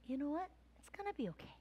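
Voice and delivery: very soft, calm comfort; hushed reassurance. Real, not acted. Pastor at peace after prayer, very soft. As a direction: let peace settle in.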